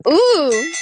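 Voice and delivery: ordinary delivery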